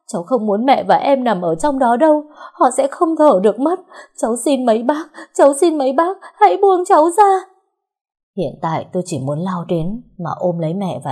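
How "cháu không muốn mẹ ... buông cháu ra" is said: high, loud sobbing plea building to a shriek; frantic grieving cry, climbing higher; desperate crying plea. Acted. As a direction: beg through tears, rising to a scream. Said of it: "cố gắng vùng vẫy để thoát khỏi bọn họ".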